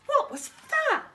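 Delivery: high, loud frightened question; alarmed cry; startled. Acted. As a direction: jump at the noise. Startled by screech.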